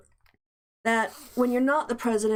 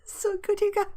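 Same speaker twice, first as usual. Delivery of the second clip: high, laughing, nearly crying with glee; 10 semitones higher than usual. Real, not acted. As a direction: gush it through giggles. Giddy, gloating over the news.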